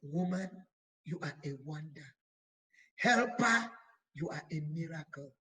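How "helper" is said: a sudden high ringing call. Real, not acted. Preacher crying out to the helpers, loud. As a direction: cry it out to the women like a summons.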